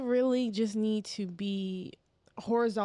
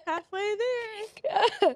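laughing; 10 semitones higher, 4 dB louder, much slower than usual. Real, not acted. Laughing about being half horse.

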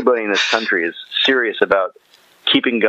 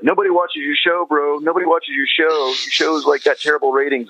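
a lightly voiced, higher mocking Alex Jones; Alex Jones taunt; 7 semitones higher than usual. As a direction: poke fun at his boasting, a touch higher. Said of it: "Alex Jones came in there and he's like".

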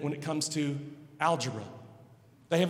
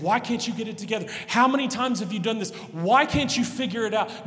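higher, louder, fast scolding rant; scolding father; 6 semitones higher, 7 dB louder, faster than usual. Retold or imitated. Father scolding his child, fast and loud. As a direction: chew them out like an angry dad. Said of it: "your father reaming you and chewing you out".

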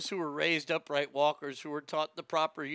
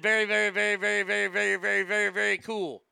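mock gushing student; 3 semitones higher, faster than usual. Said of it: "somebody wrote a paper that said".

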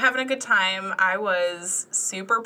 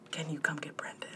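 whispered plea; 3 semitones lower, 14 dB quieter than usual. Retold or imitated. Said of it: "Tessa's like".

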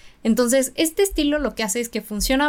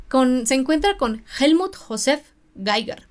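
lightly put-on German accent; 3 semitones higher, 4 dB louder than usual. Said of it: "voy a hacer ahora un acento alemán".

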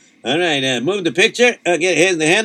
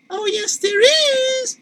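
wife voice. Acted. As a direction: play the worried wife, pitched way up. Wife voice answers husband.

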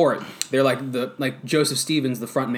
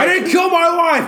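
much higher and louder hammy quote; hammed-up tough guy; 6 dB louder than usual. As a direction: overact the line.

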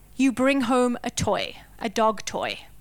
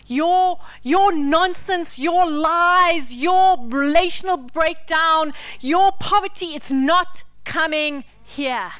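loud, slow, higher preaching cry; forceful declaration; 3 semitones higher, 5 dB louder, slower than usual. Acted. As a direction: hurl each your out like a challenge. Preacher declaring against the devil.

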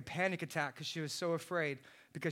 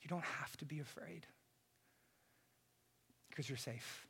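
hushed, near-whispered comfort, much softer; tender whisper; 10 dB quieter than usual. Retold or imitated. Father soothing his scared daughter at night, tender whisper. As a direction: whisper it like you're soothing a child back to sleep.

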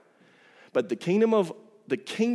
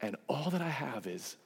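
gentle father; 5 dB quieter than usual. Acted. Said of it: "The father comes to him and goes".

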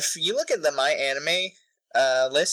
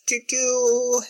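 slow, higher-pitched doo-doo-doo while thinking; filler doo-doo-doo; 10 semitones higher, slower than usual. Real, not acted. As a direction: stall with a drawn-out little doo-doo-doo. Humming while searching for a word.

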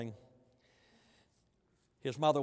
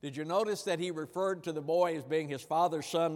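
ordinary delivery

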